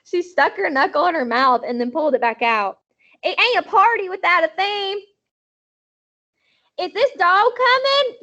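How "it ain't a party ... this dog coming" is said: put-on much higher, bossy little girl. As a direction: boss them like a tiny kid. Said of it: "Sweetie Pie Thomas voice".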